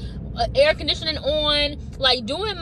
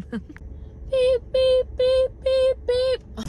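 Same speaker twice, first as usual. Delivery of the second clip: sung beep; 13 semitones higher, much slower than usual. Pretend. Imitating a horn.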